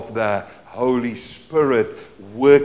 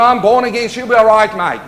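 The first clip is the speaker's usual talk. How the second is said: loud careless Australian "I'm born again, she'll be all right, mate"; Aussie accent; 6 semitones higher, 10 dB louder than usual. Retold or imitated. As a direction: swagger it in broad Australian. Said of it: "that Aussie thing".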